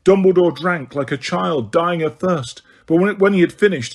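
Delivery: usual speaking voice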